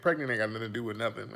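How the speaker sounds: normal talking voice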